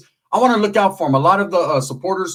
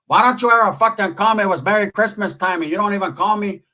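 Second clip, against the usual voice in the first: higher-pitched mock telling-off; imitated scolding aunt; 5 semitones higher than usual. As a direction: scold like an offended aunt on the phone. Aunt scolding him on phone.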